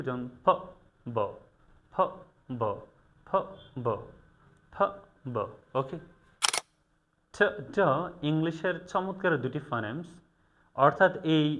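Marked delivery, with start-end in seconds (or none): 0.4-5.4 ফ and ব alternated with both lips closed; repeated phonemes. Shown as a demonstration. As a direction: drill ফ, ব, ফ, ব from the lips. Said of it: "দুই ঠোঁট ... একসাথে করে".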